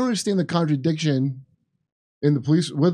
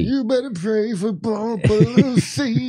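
imitazione di una donna; 2 semitones higher than usual. Acted. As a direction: stretch it out like a spoof impression. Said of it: "What's the word she says".

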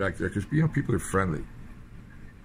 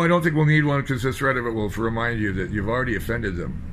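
ordinary delivery

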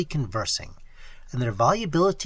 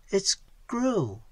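softer, higher, hesitant voice; faltering; 4 semitones higher, 7 dB quieter than usual. A narrator's reading. Evelyn hears distant flapping, faltering softly. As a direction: falter through it, quiet and uneasy. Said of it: "faltered Evelyn Gray".

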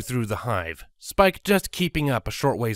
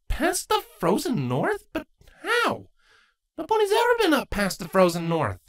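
much higher, astonished; high startled young mare; surprised Twilight. Acted. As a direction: gasp it out in disbelief.

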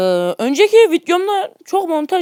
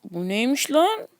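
normal talking voice

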